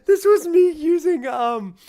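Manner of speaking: high, loud squeal through laughter; laughing while talking. Real, not acted. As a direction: squeak the words out mid-laugh. Laughing at own old transition.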